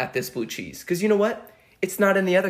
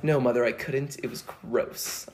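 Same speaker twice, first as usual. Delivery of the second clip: mock-refined, prim little reply; lightly put-on proper child; sophisticated kid; 4 semitones lower, 6 dB quieter than usual. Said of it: "I was obviously not this sophisticated".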